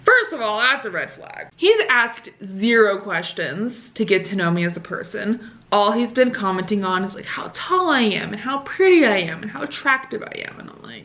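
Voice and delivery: ordinary talk, nothing marked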